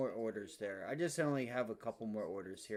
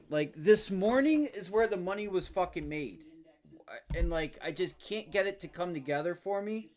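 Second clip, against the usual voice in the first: raised, louder frustration; frustrated venting; 5 semitones higher, 8 dB louder than usual. Real, not acted. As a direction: vent the missed morning loudly.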